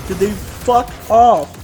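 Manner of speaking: normal talking voice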